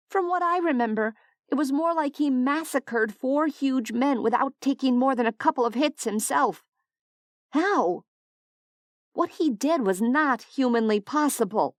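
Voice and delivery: Della, disbelieving. A narrator's reading.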